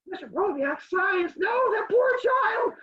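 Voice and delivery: high, loud, drawn-out wail; mock outrage. Real, not acted. Mock horror at the science class.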